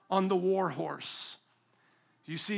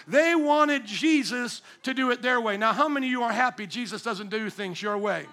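ordinary delivery

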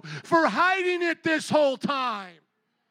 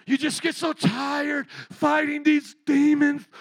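ordinary talk

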